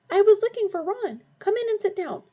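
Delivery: put-on high, loud young girl. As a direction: call out as a girl. Voiced character in dark.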